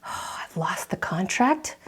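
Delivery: voiceless, despairing whisper. Retold or imitated. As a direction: let the loss slip out under your breath. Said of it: "ball up your fist and you're like".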